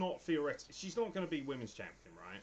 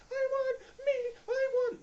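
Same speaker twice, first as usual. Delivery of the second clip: much higher, much louder squealed victory cry; mocking Lana's gloat; 10 dB louder, slower than usual. Mocking Lana celebrating a win she didn't earn, shrill shout. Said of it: "celebrating and going".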